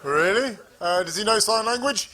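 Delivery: put-on South African accent. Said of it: "this funny accent".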